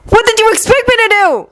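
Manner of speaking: very high pleading cry; desperate retort. Acted. Yuri defends himself to Victor, high and upset. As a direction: fling the question back.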